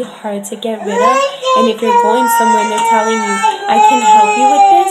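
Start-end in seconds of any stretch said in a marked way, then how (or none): 3.7-4.9 much higher and much louder pitch; a loud, high, mocking salesperson's promise; mock sales pitch. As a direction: belt it like a pushy promise. Mimicking the clinic's sales pitch.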